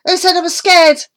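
high, loud and defensive; indignant boy. Acted. Dennis protesting to Amy that he is not scared. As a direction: bristle at the very idea.

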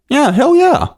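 put-on high-pitched, enthusiastic kid's yes; excited child. Eight-year-old saying yes to the circus, excited. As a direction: jump at the offer in a high voice.

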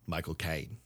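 low, soft mock Cockney "Michael Caine"; Michael Caine accent. Friend asks for Michael Caine in his accent, low mock Cockney. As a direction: drop it low and quiet in a Cockney voice. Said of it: "Say Michael Caine in his accent".

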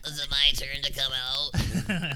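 slow, meek little voice; timid Leonard. Acted. Forgotten son asking from the closet, meek and slow. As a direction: peek out shyly from the closet.

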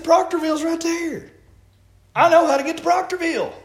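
high-pitched, exasperated retelling of an objection; insistent protest. Retold or imitated. Driver arguing directions with Sister Crystal, insistent protest. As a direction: argue the route, pitched well up. Retells dispute with passenger over route.

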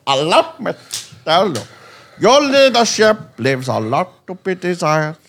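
slow, loud, high mock statesman; hammed-up South African impression; Nelson Mandela. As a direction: proclaim it in a badly faked South African accent.

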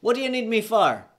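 high, loud Jamaican character voice; Jamaican accent. Acted. Podcaster acts a sled team scene, heightened Jamaican accent. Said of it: "I gotta heighten my voice right now".

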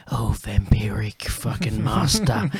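slow, whispery goth-metal stage voice; mock a Cockney goth singer intoning darkly; drawn-out, voiceless Cockney vampire theatrics. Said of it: "he's all just like".

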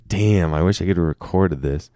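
normal talking voice

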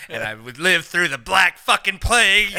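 loud slow pompous claim; loud slow hammed-up boast; mock grand boast. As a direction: declaim it grandly.

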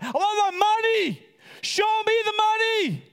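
slow, high, hammed-up shout; exasperated yell. Lender silently demanding his money from the friend, yelling. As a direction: stretch each demand into a loud, high yell.

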